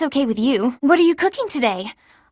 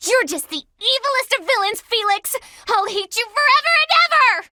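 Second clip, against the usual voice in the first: flustered tantrum; 8 semitones higher than usual. Acted. Embarrassed tantrum at Felix.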